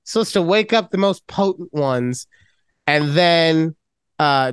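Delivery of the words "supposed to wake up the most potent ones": ordinary talk, nothing marked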